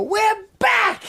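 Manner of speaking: high arena shout; rock star shout. Retold or imitated. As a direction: belt it to the crowd like a frontman. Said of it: "and I go".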